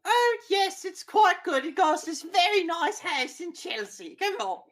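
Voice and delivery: put-on high, loud old lady voice; squeaky elderly woman beckoning. Bertha voiced by GM.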